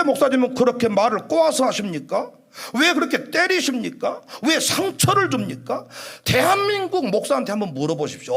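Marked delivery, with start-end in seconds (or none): none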